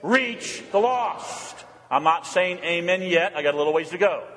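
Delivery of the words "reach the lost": slow, deliberate and spaced; preacher leading the congregation in slow unison; slow call and response. Shown as a demonstration. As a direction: say it with them, slowly. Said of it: "Say it".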